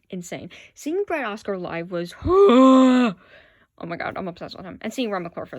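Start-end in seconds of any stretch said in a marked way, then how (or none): 2.2-3.1 huge, loud fangirl gasp; loud swooning "oh" on a big breath; awestruck gasp. Real, not acted. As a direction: gasp it out, overwhelmed. Swooning over seeing favorite performer live.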